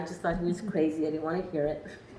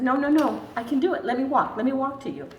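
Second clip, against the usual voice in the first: higher and louder, eager no-no-no; excited insistence; 6 semitones higher, 4 dB louder than usual. Retold or imitated. To her husband, refusing help, excited and eager. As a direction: burst out with joy at walking alone.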